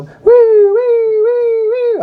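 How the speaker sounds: high, loud imitated police siren; siren noise. Comic imitates the police siren heard over the phone, high and loud. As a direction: wail it like a siren. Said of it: "He put his phone out the window".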